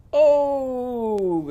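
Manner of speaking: loud high groan; rowing groan, loud and high; great big groan. Shown as a demonstration. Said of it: "give a great big groan".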